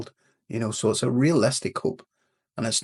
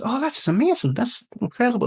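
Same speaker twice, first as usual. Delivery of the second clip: much higher, gushing with amazement; excited neighbour; 14 semitones higher than usual. Acted. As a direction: play the neighbour overjoyed at the horse's return.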